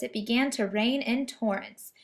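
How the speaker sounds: usual speaking voice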